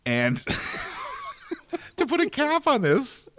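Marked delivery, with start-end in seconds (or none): 0.5-1.8 soft high laugh. Real, not acted. Quiet laugh mid story.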